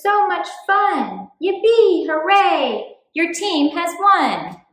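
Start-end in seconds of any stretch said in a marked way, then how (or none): none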